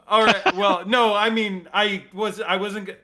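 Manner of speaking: fumbling, hurt little goodbye; awkward NPC voice. Acted. Captive fumbles after rejection.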